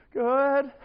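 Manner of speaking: high, loud, comically panicked reply; mock nervous. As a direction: squeak it out like a scared boy. Terrified boy answers girlfriend's dad.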